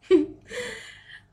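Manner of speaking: high, loud burst of real laughter. Real, not acted. Laughing at her own sandwich love, high and loud.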